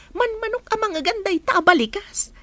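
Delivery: ordinary talk, nothing marked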